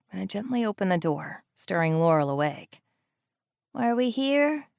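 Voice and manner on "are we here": put-on high, small child's voice just waking up; sleepy little girl. A narrator's reading. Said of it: "her voice still scratchy".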